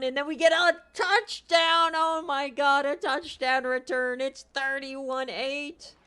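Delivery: high, loud cry of shock; loud, high wail of disbelief, slowing at the end; disbelieving yell. Real, not acted. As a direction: shout in despair at the screen. Touchdown return against his team.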